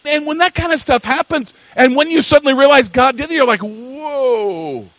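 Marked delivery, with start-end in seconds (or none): 3.6-4.9 long, drawn-out whoa; stunned whoa. Acted. Preacher acting out realizing God did it, stunned. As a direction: let a soft, amazed whoa fall slowly.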